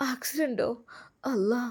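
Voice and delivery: soft, high gasp of a question; acted faint shock; shocked dismay. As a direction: echo the news in hushed alarm. Hears of the accident, dismayed.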